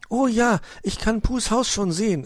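put-on high, excited young nephew; thrilled, high-pitched delight; enthusiastic. A narrator's reading. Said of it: "erwiderte dieser begeistert".

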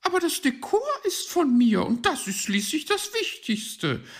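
high, fast, loud magpie retort; defiant high-pitched magpie; undaunted. Acted. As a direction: fire straight back, unafraid. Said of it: "gab sie unerschrocken zurück".

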